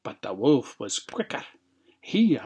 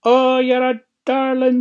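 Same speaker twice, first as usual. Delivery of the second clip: high, loud fake granny exclamation; put-on old lady voice; 11 semitones higher, 11 dB louder than usual. A narrator's reading. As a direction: coo loudly as a wolf playing granny. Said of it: "put on his best old lady's voice".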